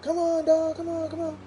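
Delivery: high calling out, dropping off at the end; high-pitched call for a dog. Retold or imitated. Neighbor calling his runaway dog, high mock call. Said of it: "he's screaming for his dog".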